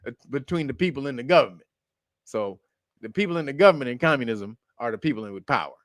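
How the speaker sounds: ordinary delivery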